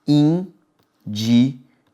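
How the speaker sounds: dictating indivíduos syllable by syllable. Shown as a demonstration. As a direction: slowly split into syllables, in-di-ví-duos. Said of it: "Olha só".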